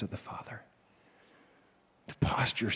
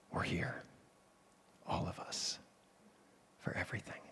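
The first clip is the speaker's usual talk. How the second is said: whispered, much softer, guilty answer; hiding whisper; 4 semitones lower, 10 dB quieter than usual. Acted. Children hiding from the Father, whispered answer.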